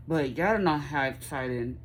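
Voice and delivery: ordinary talk, nothing marked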